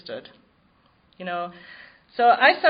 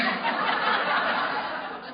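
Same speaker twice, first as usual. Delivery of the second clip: real laugh. Real, not acted. Laughs at own remark.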